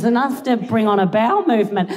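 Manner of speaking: usual speaking voice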